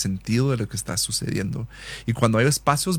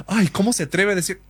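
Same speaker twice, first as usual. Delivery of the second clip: higher, hammed-up indignant voice; imitating himself taking offence, pitch up; mock outrage; 7 semitones higher than usual. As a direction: play the offended one in your head. Rehearsing an imagined fight.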